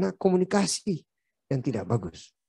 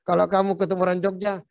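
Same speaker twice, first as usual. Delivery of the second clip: usual speaking voice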